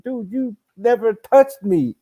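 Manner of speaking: indignant retort. Retold or imitated. Boxer quoting himself to a bragging sparring partner, indignant. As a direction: scoff at his claim.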